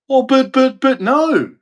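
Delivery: high, stammered mock excuse; mocking protest. Mocking the cop-caller's excuse over a minor thing, high mock protest.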